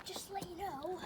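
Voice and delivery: unvoiced hushed aside; whispering. Real, not acted. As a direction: breathe it so no one hears. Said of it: "Why are you whispering it?".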